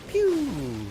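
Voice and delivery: squeaky laser pew; high pew sound effect. Pretend. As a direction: toy blaster zap.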